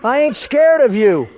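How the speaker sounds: loud, high defiant taunt; defiant shout. Acted. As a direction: yell it back at the monster. Taunting the monster teacher.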